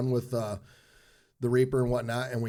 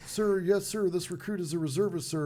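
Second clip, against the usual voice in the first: much higher, stiff recruit answer; mock recruit reply; 8 semitones higher than usual. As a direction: rattle it off like a recruit at attention.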